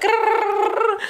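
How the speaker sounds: high playful growl; mock growl. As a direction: growl at the shaky stairs. Mock growl at wobbly stairs.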